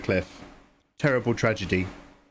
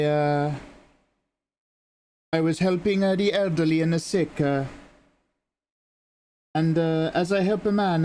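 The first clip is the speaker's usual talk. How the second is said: higher, hesitant Italian nun; lightly voiced Italian nun, higher and faltering; Italian nun confessing; 5 semitones higher than usual. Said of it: "And she goes".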